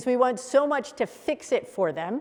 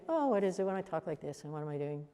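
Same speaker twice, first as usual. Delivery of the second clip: soft voice sinking lower; low, soft demo; 6 semitones lower, 10 dB quieter, faster than usual. Shown as a demonstration. Coach contrasting tones for a class, soft and low. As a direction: drop it down low and hushed. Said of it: "What's the tone difference?".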